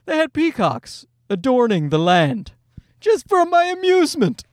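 rich guy impression. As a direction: play a pampered tourist, rising to a high finish. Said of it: "second one was like".

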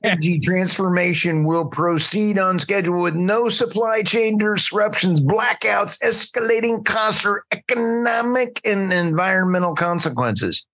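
high-pitched, sarcastic sales pitch; mock optimism. Host mocking Biden's green energy plan, sarcastic high-pitched optimism. Mocking planners' rosy promises.

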